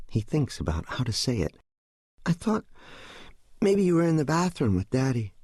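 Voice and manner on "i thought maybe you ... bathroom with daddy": put-on high-pitched, tentative little boy; young son. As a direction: voice a child raising something hesitantly, high. Narrator voices small son, hesitant.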